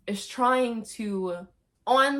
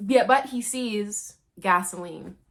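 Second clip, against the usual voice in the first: ordinary delivery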